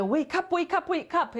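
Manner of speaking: chirpy sing-song wake-up; excited Anna wake-up call. Retold or imitated. Teacher imitating Anna from Frozen waking her sister. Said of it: "jak Anna w El-, i w tym, we Frozen".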